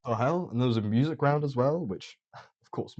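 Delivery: normal talking voice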